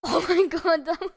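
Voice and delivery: loud laughing exclamation. Real, not acted. Player laughing after a scare. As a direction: laugh through the words.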